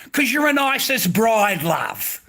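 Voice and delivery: loud, high-pitched angry outburst; angry accusation. Real, not acted. Man berating an ISIS bride, angry accusation.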